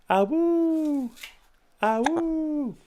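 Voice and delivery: playful high wolf howl. Pretend.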